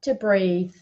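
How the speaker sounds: unhurried guided relaxation for the live audience; guided breathing. Acted. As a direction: lead them into calm, one phrase at a time. Leads a breathing exercise.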